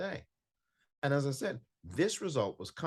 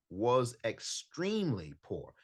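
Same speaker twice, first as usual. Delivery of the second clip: slow, leaning hard on "extremely"; stress on extremely; slower than usual. Retold or imitated. Host quoting a Watford legend's commentary, heavy stress on extremely. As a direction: drag out "extremely" with heavy weight, slower. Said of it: "put the emphasis on the word extremely".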